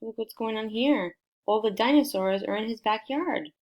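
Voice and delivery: ordinary delivery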